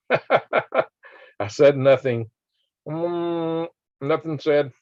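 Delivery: slow, laughing denial; giggling "I said nothing". Real, not acted. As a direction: say it through a laugh, slowly.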